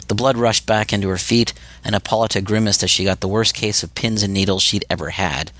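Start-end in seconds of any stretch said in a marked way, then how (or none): none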